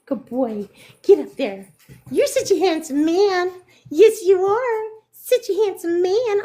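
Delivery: high, sing-song pet voice. Real, not acted. Cooing at her dog.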